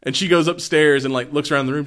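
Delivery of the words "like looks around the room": ordinary delivery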